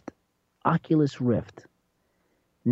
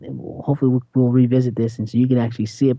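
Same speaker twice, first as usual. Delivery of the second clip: ordinary delivery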